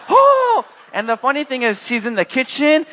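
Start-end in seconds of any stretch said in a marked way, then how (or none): 0.1-0.6 very high, loud, drawn-out "oh"; mom's astonished shriek, mimicked; imitated shocked cry. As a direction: let out a piercing whoop of shock. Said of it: "she's just shocked".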